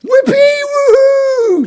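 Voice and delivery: very high, loud whoop; squealed, jubilant hurrah; cheering. A narrator's reading. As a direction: whoop long with sudden joy. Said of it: "cheered the yak".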